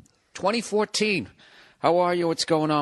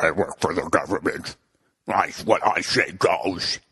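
smug government inspector; 6 semitones lower than usual. Retold or imitated. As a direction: lay down the law like a petty bureaucrat. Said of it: "And he's like".

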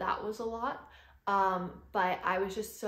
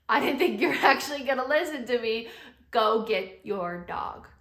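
retold exclamation; 3 semitones higher, 7 dB louder than usual. Mom relays dad's surprising words.

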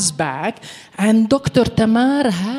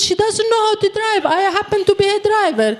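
higher, faster, pushing the point home; mock-innocent insistence, quicker and higher; insisting to the soldier that the dog can't drive; insistent repeat; 11 semitones higher, much faster than usual.

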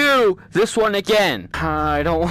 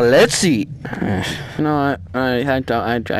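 ordinary talk

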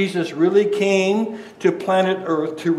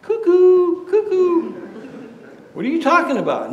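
high, mocking sing-song cuckoo call; mock the speaker as crazy; sing-song scoffing at a madman; 13 semitones higher than usual. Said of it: "you'd say".

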